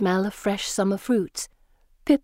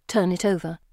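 ordinary delivery

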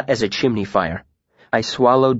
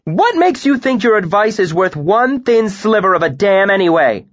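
loud, high angry outburst; sudden raised retort; bursting out; 10 semitones higher, 4 dB louder than usual. A narrator's reading. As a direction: explode back at him. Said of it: "Denna burst out".

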